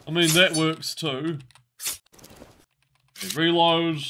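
ordinary delivery

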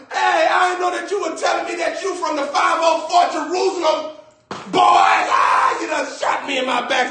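high panicked yelling, being shot at; screaming while shot. Acted. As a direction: scream in terror as shots hit. Acted getting shot, screaming.

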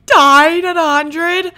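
loud, high, slow disbelief; outraged shout. Real, not acted. Player shouts slowly about dying at a hundred. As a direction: wails it out in outrage. Reacting to the character's death.

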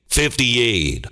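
ordinary talk

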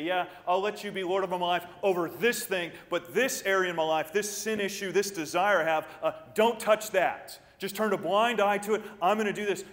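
loud, high defensive bargaining; bargaining believer. Retold or imitated. Preacher voicing a believer bargaining with Jesus, loud defensive. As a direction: hold back your pet sin from God.

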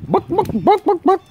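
high squawky bawk bawk; chicken clucking. Retold or imitated. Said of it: "You're chicken".

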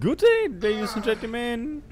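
slow, high mock villain farewell; imitating the villain. Gamer mimicking the game villain's parting words, slow high hammed-up voice. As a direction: ham up the villain's goodbye. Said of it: "Ich mag ihn".

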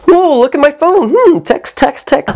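high mock-distracted "look at my phone"; bored viewer. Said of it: "I'm just like".

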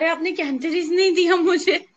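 complaining patient. Acted. Patient coming back to the doctor to demand Cantharis, complaining.

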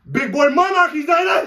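loud, proud shout of the shoe name; excited brag. Acted. Bragging to his friend about the last pair, loud and proud. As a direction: crow it out like a trophy.

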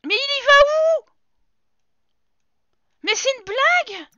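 high, loud disbelief; genuinely baffled outcry; high-pitched "is this a joke" outrage; incredulous. Real, not acted. Sim wanders off.

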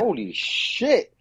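high, drawn-out amazed reaction; retold astonishment. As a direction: stretch it out in mock awe. Retold shock after looking Kylie up.

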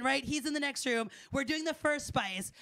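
ordinary talk, nothing marked

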